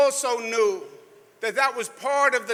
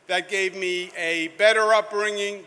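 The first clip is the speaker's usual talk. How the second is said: ordinary delivery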